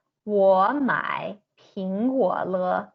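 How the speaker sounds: slow, clear Mandarin read for learners; careful Chinese example. Shown as a demonstration. Teacher reading a Mandarin example slowly to learners. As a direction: say "我买苹果了" slowly, tone by tone. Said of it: "Next, we've got".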